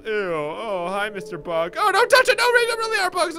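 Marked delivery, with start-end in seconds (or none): 1.8-3.3 high, loud disgusted warning; alarmed outburst. Acted. Warning not to touch the bug, loud alarm. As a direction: cry out in alarm at the bugs.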